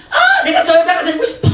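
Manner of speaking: high mock squeal of longing; hammed-up envious squeal; jealous fangirl squeal. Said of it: "라는 질투심이".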